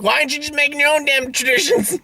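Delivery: high, fast exasperated rant; exasperated quote. Acted. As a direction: rattle it off high in mock disbelief. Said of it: "it's like".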